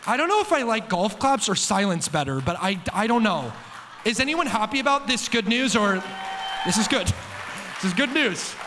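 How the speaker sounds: loud teasing push for a response. Real, not acted. Preacher prodding the silent congregation to cheer, loud teasing.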